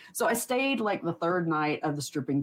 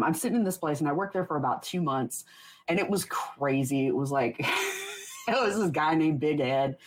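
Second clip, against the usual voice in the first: normal talking voice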